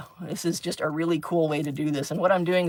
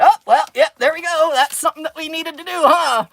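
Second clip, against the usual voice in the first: higher, louder mock cheer; sarcastic; 9 semitones higher, 6 dB louder than usual. Teacher teasing a kid who swore, higher mock cheer. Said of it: "I went".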